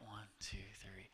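soft whispered counting, fading out. Shown as a demonstration. Preacher counts the congregation through a breath in a whisper. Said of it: "let's all just do that".

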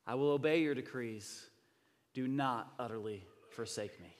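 slow, weighty public reading; scripture reading. Real, not acted. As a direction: proclaim the psalm phrase by phrase with pauses. Reads the psalm aloud to congregation.

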